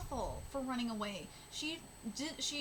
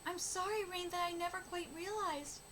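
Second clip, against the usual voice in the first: mild tearful apology; 7 semitones higher than usual. Acted. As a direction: apologise gently as if dying. May's dying apologies read aloud.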